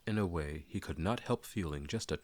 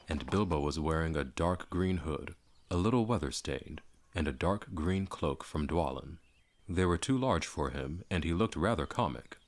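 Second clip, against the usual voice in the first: ordinary delivery, nothing marked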